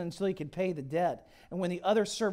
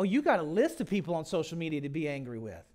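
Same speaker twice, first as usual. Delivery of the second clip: normal talking voice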